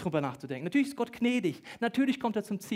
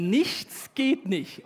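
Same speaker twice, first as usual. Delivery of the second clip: NICHTS drawn way out, higher and louder; emphatic insistence; 6 semitones higher, 6 dB louder, much slower than usual. Acted. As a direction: stretch "Nichts" slowly and push it hard. Insists an answer is required. („Nichts“ lang gedehnt und laut betont)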